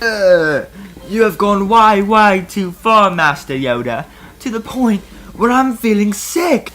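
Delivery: sickened and appalled; disgusted. Acted. Obi-Wan disgusted after vomiting.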